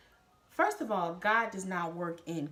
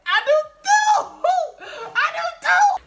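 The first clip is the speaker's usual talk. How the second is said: shrieked far higher and louder, laughing; mock overjoyed shout; 14 dB louder than usual. Answering her boyfriend's breakup with a pretend joyful shriek. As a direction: squeal it like the best news ever. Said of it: "I just got so happy inside, but I didn't really do that".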